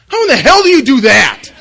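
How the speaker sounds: shouted high and loud, exasperated; furious outburst. Retold or imitated. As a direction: blow up at the sponsor in disbelief. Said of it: "I was just furious".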